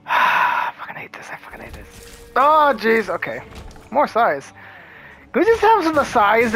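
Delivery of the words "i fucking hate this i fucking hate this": sighed, whispered complaint; real exasperated whispering; frustrated whisper. Real, not acted. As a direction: whisper it to yourself, fed up. Frustrated muttering while playing.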